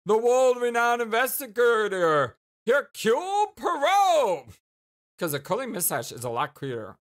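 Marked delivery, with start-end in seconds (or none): 0.1-4.5 loud, high, drawn-out fanfare reveal; grand announcement. Acted. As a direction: announce the famous name like a showman. Grand reveal of a famous detective.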